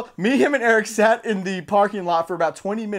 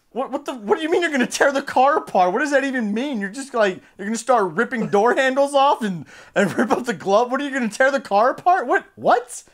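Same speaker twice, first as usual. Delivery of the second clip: higher-pitched, baffled retold protest; incredulous retort; 5 semitones higher, 3 dB louder than usual. Storyteller challenging the car threat, retold incredulous outburst. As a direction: pile up questions in mock disbelief.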